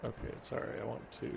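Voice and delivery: ordinary delivery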